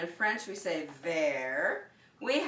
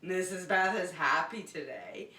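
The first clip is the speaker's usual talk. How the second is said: usual speaking voice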